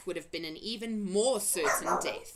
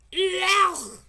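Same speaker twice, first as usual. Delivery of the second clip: huge, high roar of disgust; disgusted giant roar; 13 semitones higher, 12 dB louder than usual. A narrator's reading. Giant spitting out the vegetable, roaring in disgust. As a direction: bellow out your revulsion. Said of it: "roared the blood bottler".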